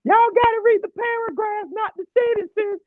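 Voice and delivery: high, loud exasperated teacher voice; complaining teacher. Retold or imitated. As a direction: squeal it like a fed-up teacher. Mocking teacher's complaint in class.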